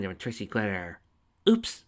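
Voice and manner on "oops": sheepish. A narrator's reading. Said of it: "Lyra said sheepishly".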